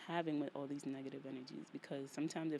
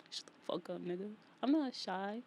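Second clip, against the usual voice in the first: ordinary delivery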